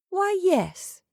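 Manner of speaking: unruffled. A narrator's reading. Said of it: "admitted Marcia, unruffled".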